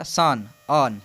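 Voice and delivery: "सन", then its ending "on" alone; teacher separating "on" out of "सन"; syllable demonstration. Shown as a demonstration. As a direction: sound out "सन", then isolate "on". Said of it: "सन में on आता है".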